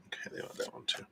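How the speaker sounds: whispered aside to herself; soft unvoiced aside. Real, not acted. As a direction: breathe it out as a private whisper. Aside while pulling cards.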